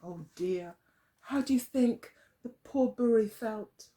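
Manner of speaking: ordinary delivery, nothing marked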